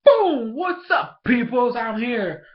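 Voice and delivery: high, loud, cocky shout-out; hyped-up Barnaby entrance. Retold or imitated. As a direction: burst in like a show-off making an entrance. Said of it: "Barnaby's like".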